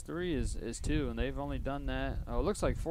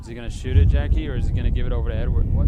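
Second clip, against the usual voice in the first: excited call; 13 dB louder, faster than usual. Real, not acted. Live call of a shot chance.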